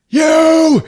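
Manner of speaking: high, very loud outburst; accusing shout. Retold or imitated. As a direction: roar it at the culprit. David confronts Ralph, accusing.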